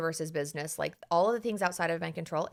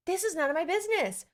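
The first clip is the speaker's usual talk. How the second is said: breezy dismissal; 11 semitones higher than usual. Retold or imitated. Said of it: "cool story bro was like".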